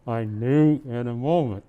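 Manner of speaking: reading a Christmas poem aloud; gentle storybook reading; story narration. Acted. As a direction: read it like a bedtime tale to a child. Santa reads poem to child.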